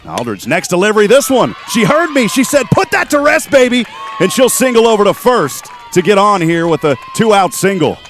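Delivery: live hit call, voice leaping up high; excited play-by-play. Real, not acted. Announcer calls a two-out single to listeners, excited.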